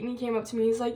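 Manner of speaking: ordinary talk, nothing marked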